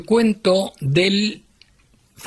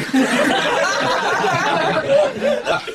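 high burst of laughter at his own punchline; big real laugh. Real, not acted. The teller laughing at his own joke, a high real laugh. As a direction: cracks up, laughing high and free.

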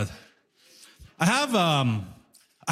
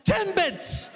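impatient customer; 10 semitones higher than usual. Retold or imitated. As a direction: say it like it's obvious. Customer repeating word impatiently.

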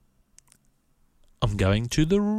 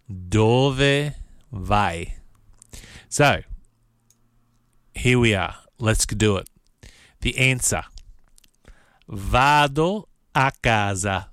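ordinary delivery

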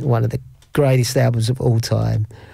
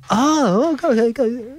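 flustered realisation; 11 semitones higher than usual. Retold or imitated. Acted reaction on learning who he is.

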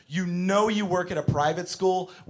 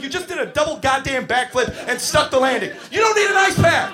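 high, rising rant; exasperated shout; 8 semitones higher, 5 dB louder than usual. Retold or imitated. Teacher to little girl faking hurt, exasperated yelling. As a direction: build to an incredulous yell. Teacher snaps back at child.

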